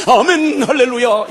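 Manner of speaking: family dutifully shouting amen to the father; eager amen. Retold or imitated. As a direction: imitate the family's instant hallelujah. Family's forced enthusiastic response.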